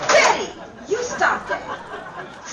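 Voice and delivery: scolding. Acted. Anderson scolds Betty's phone prank.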